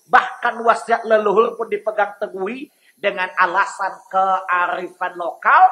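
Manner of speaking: normal talking voice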